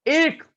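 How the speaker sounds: एक punched high and loud; stressed word. Shown as a demonstration. Teacher drives home एक to class, punched. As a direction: hit एक hard.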